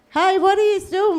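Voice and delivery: high, loud challenge from the angry driver; confronting yell. Retold or imitated. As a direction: demand an answer at the car window. Driver confronts them at window.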